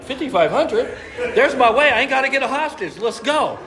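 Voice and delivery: high, loud, laughing burst of excitement; hyped up. Retold or imitated. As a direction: blurt it out, thrilled about the money. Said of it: "So I'm hyped up".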